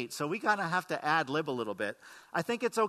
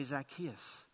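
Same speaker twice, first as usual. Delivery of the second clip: softer, lower, calm invitation; gentle call; 6 semitones lower, 9 dB quieter than usual. Retold or imitated. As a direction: speak it softly, like calling a friend down. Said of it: "and say".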